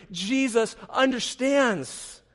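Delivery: usual speaking voice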